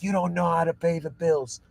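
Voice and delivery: a hint of a cheeky toddler talking back to its parents. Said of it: "It's like a toddler telling".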